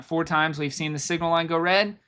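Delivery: ordinary delivery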